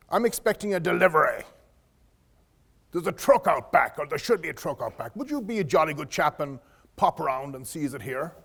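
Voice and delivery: lightly imitated posh English gentleman; a hint of a polite, posh English accent. A light posh English accent as the interviewer sends the applicant out to check a truck. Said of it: "in a very posh English accent".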